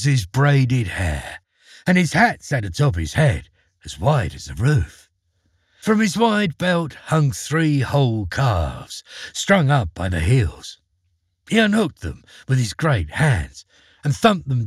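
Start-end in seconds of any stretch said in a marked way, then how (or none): none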